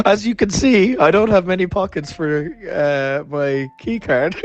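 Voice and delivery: high voice easing down toward the end; high, self-mocking politeness; mock-sheepish explanation. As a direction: pretend to explain yourself while mortified. Acted plea to receptionist, invented.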